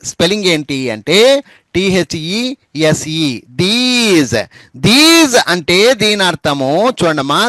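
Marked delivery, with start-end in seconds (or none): 1.7-4.4 slow spelling drill. Shown as a demonstration. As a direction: spell out "టీహెచ్ఈఎస్ఈ" letter by letter very slowly, then say "these". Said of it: "మళ్ళీ ఒకసారి these అని పలకాలి".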